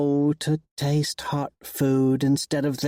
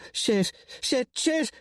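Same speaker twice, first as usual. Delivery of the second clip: rising high, breathless curses; climbing in pitch as the trap springs; panicked swearing; 11 semitones higher, slower than usual. Acted. Caught girl realizing the trap, panicked high swearing.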